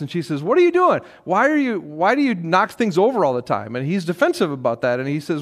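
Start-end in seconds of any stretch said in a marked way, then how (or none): 0.4-3.7 high, louder, scolding outburst easing slightly; acted-out exasperated telling-off, pitched up; mock raised voice. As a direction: scold a clumsy spouse, voice raised. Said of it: "She raises her voice".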